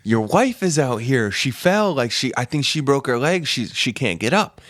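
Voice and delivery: high, urgent retold alarm; mock screaming. Tripping stranger telling the man his wife fell, frantic. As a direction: imitate calling frantically into a house, starting high and easing. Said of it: "halfway in this person's house screaming".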